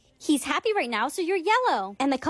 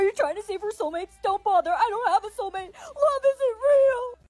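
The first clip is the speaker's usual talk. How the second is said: crying; 6 semitones higher than usual. Acted. Sad Sam rejects soulmate.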